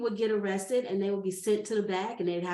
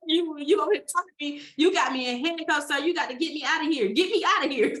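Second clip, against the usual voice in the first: louder, quick, indignant begging; frantic pleading; 6 semitones higher, 6 dB louder than usual. Retold or imitated. Handcuffed in the police car, begging the officer. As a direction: demand to be let out, rushing.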